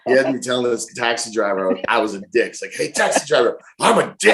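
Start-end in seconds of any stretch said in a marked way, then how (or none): 2.7-4.3 high mock announcement, getting higher; gleeful holler. Pranked passenger proclaiming it to taxi driver, high mock holler. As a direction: holler it proudly at a stranger. Mocks his own call to driver.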